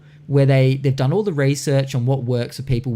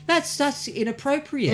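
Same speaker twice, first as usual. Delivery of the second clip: prissy disapproval; 10 semitones higher, 4 dB quieter, slower than usual. Retold or imitated. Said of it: "someone would be like".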